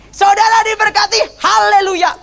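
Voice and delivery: high-pitched imitated preacher's blessing; Gilbert. Gilbert memberkati saudara di KKR, high imitated proclamation. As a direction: proclaim it far above your own pitch, like a revival preacher. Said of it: "Dia ngomong gini".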